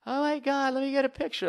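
very high, starstruck squeal; excited fan. Acted. Fan asking fake [name] for a picture, high squeal. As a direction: gush in a high put-on voice.

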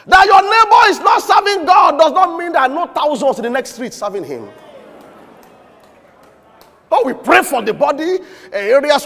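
loud, high preaching burst; preacher shouting a rebuke, then dropping. Acted. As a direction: thunder it at the congregation. Preaching at full force.

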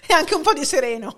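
loud, high laughing joke. Real, not acted. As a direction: laugh it out, playful. Joking sign-off, both laughing.

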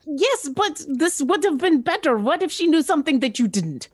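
high-pitched creature voice, arguing; protesting creature character. Acted. Player argues as creature character.

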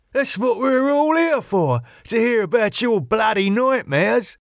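high, jeering mate's voice; teasing badgering. Jimmy keeps mocking Wayne.

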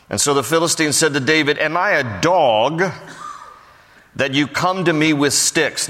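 solemn scripture reading voice. Acted. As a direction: read the verse out to the congregation. Reads scripture aloud.

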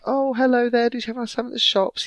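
high, fast, sugary and loud; mock sweet mother. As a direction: sound innocent while fishing for gossip. Voicing mother, sweetly suspicious.

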